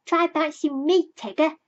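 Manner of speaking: high, loud, eager plea; a small child begging to play; eagerly. A narrator's reading. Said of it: "said Roo eagerly".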